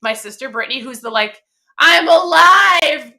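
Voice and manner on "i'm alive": loud, higher, drawn-out exclamation; dramatic mock yell. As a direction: stretch it out in an exaggerated shout. Sister's dramatic correction, retold.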